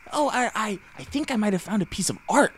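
put-on high-pitched, stammering kid; hesitant young student. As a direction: pipe up tentatively in a high voice. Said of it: "with his students".

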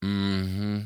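drawn-out hum on one note; disinterested mm-hmm. Shown as a demonstration. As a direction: demonstrate a listener who isn't interested. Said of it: "I'm gonna do it".

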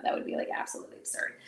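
fast breathless whisper, barely voiced; whispered aside. Real, not acted. Talking to viewers, a fast whispered aside. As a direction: throw it away under your breath.

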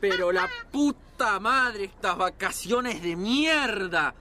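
slow, weighty swearing; exasperated cursing. Acted. Father fed up with the holiday, slow exasperated cursing. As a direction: drag out each curse in frustration.

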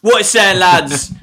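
loud, high-pitched mock greeting to the lads; Alfie. Host mimics absent co-host greeting lads, loud impression. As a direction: belt out a co-host's catchphrase. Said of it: "that was my Alfie impression".

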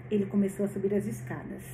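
usual speaking voice

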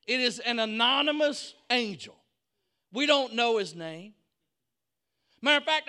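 high, loud pulpit proclamation; full-force preaching. Real, not acted. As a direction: proclaim it to the whole congregation, driving each phrase.

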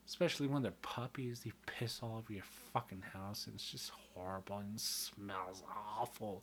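soft unvoiced whisper; whispered aside. Real, not acted. Vlogger whispering to viewers about puppy mess. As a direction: drop to a whisper, gross secret.

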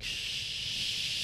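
long hushing "shh"; acted-out hush; drawn-out shush. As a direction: shush someone at length. Retelling her hushing him.

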